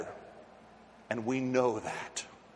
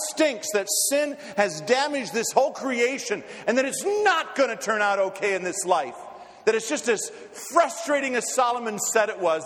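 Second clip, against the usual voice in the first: raised, high-pitched sermon delivery; urgent, emphatic pulpit oratory; impassioned preaching; 9 semitones higher, 4 dB louder than usual. Real, not acted. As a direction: preach it with rising fervour, punching key words.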